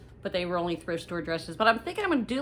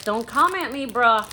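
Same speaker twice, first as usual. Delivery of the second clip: sassy; 4 semitones higher, 9 dB louder than usual. Acted. Teasing viewer Eric.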